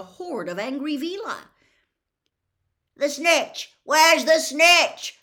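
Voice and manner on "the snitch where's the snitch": high, very loud, drawn-out call; urgent full-throated shout; bellow. A narrator's reading. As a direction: bellow it along a row of seats. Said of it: "bellowed Charlie along the row".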